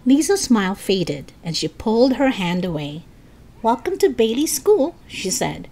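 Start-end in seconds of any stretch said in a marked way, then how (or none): 3.6-4.9 higher, slightly guarded welcome; less friendly. A narrator's reading. Lisa welcomes Mister Stone, less friendly. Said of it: "didn't sound quite as friendly as before".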